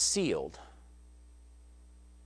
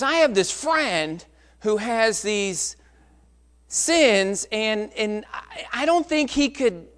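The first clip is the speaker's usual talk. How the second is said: higher, halting, drawn-out delivery; hesitant confession; 5 semitones higher, 4 dB louder, slower than usual. Retold or imitated. Boy asking a preacher about his friend's sins, hesitant. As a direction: imitate a boy edging into a confession.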